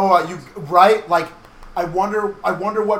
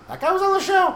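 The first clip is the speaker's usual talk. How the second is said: surprised; 4 dB louder than usual. Retold or imitated. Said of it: "you're like".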